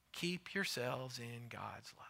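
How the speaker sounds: breathy whispered refrain. Real, not acted. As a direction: whisper it like a secret blessing.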